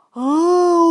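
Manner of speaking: long, howled "aooo"; drawn-out beast howl; bear's scare roar. Acted. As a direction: roar like a pouncing bear. Bear leaps out to scare her.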